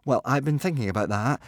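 plain reading voice

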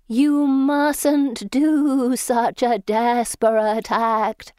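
slow, high, quavering otherworldly voice; quavering ghost voice. Acted. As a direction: draw it out like a wavering spirit. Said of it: "in a quavering voice that I hope sounds otherworldly".

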